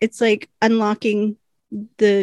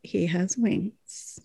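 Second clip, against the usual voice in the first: slow, hushed, stunned; stunned gasp; 2 semitones lower, 9 dB quieter, much slower than usual. Retold or imitated. Winona stunned to see Julian's wings, hushed slow gasp. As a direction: breathe it out in slow shock. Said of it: "Winona just gasps and's like".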